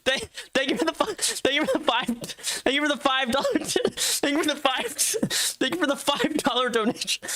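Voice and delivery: giggling through the words; can't stop laughing while reading. Real, not acted. Laughing through donation thanks.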